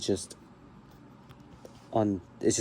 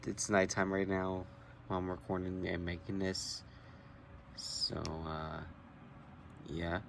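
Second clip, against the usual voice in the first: soft, hushed late-night voice; quiet at night; 12 dB quieter than usual. Real, not acted. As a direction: keep it down, people are asleep. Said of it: "I'm trying to stay quiet".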